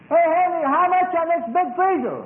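high-pitched, drawn-out yell; salesman's high, stretched-out call; calling across the store. Retold or imitated. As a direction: holler it to the boss across the floor. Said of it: "he yells and says".

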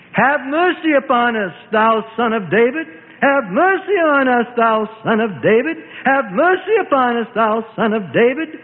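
high, repeated pleading cry; loud cries. Acted. Blind men call after Jesus in the crowd, high pleading cry. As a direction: cry out to be heard over a crowd. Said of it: "These loud cries".

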